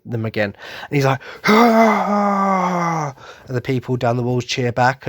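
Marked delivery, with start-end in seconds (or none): 1.5-3.2 high, loud whoop of joy; joyful cheering cry. Retold or imitated. Imitating Irvin cheering back at the crowd, joyful. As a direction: cheer back like a delighted child. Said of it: "childish joy".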